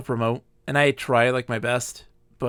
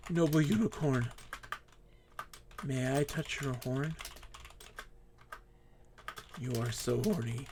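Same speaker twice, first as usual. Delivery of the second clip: hushed; 9 dB quieter than usual. Real, not acted. Hushed approach to the unicorn.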